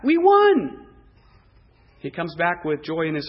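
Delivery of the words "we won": high, loud triumphant shout; excited victory cry. Acted. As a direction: cheer it out in triumph. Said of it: "Saul's excited".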